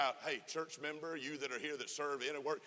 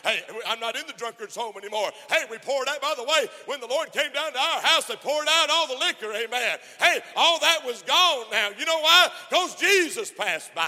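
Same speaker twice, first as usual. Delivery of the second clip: full-force preaching; 10 semitones higher, 15 dB louder than usual. Real, not acted. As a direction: lift the church with it, ringing out.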